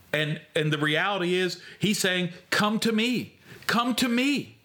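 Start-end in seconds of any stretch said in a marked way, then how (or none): 2.5-4.6 urgent call. Acted. Preacher voicing Jesus' call.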